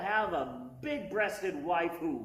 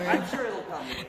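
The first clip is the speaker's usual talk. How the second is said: louder, quicker reassurance to the crowd; louder take; faster than usual. Shown as a demonstration. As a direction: say it out loud to the room, a little fast. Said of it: "repeats it more softly".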